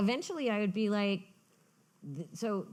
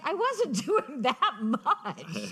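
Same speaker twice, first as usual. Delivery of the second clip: laughing mother; 8 semitones higher, 4 dB louder, much slower than usual. Retold or imitated. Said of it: "She's like".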